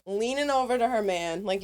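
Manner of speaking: normal talking voice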